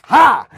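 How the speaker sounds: sudden high shout "Ha!"; loud bark laugh. Retold or imitated. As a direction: blurt a loud sharp ha. Jane Lynch's loud reaction.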